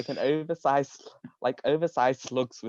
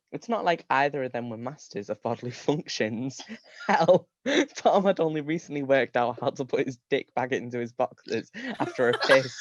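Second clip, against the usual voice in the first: plain reading voice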